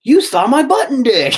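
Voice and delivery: high, loud gleeful blurt; gleeful punchline. Retold or imitated. Telling the nurse she saw him, high gleeful blurt. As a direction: blurt it out like the best joke ever. Punchline quote, then he laughs.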